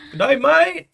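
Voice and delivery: high, loud Australian caricature; over-the-top Aussie impression; mock Aussie accent. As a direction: drag it out in hammed-up Australian. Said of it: "mix it up with the Aussie accent".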